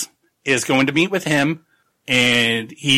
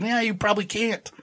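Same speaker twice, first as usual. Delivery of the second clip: high mocking; 8 semitones higher, 7 dB quieter than usual. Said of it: "he's like".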